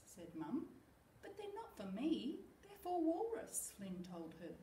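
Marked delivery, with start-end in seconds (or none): 1.2-3.7 put-on child voice. Child protesting.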